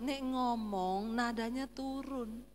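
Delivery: soft, slow tutoring with dropping ends; soft-spoken Solo husband. Retold or imitated. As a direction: coach softly, letting the tone sink. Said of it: "dia bilang". (suami Solo mengajari dengan pelan)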